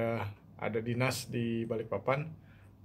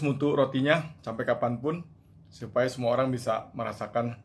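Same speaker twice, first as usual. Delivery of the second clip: talking while biting bread; chewing between words; mouth full of bread; 3 dB louder than usual. Real, not acted. Seen 4: bread held at mouth, bite taken around 2.3-2.6, then chewing.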